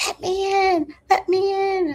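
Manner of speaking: high, loud pleading let me in; mimicking woman at gate. Neighbor woman at the gate at four, high loud pleading.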